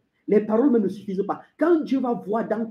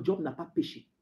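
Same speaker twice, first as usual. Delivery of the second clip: low, soft declaration; quiet statement; 4 semitones lower, 9 dB quieter than usual. Acted. Preacher citing the Bible on Job, quiet.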